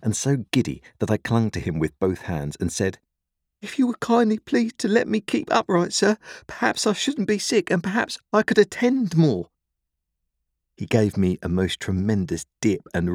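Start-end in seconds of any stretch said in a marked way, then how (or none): 3.6-9.5 put-on very high, pleading child; dreadfully frightened. A narrator's reading. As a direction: beg politely, giddy with fright. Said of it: "I was dreadfully frightened, and so giddy".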